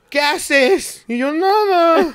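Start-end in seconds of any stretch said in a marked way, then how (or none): 0.1-1.0 high, loud call across the room; mock yell. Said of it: "Mojarra me grita". 1.3-2.1 very high, loud choked denial; tearful denial. Retold or imitated. Answering while crying.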